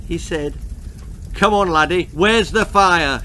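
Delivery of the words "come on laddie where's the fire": shouting. A narrator's reading. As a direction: bark it at the boy. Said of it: "shouted the fire chief again".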